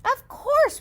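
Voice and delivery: high, cheerful builder; builder's reply. Acted. Builder agreeing to sell bricks, brightly. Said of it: "replied the builder".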